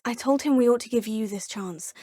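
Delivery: normal talking voice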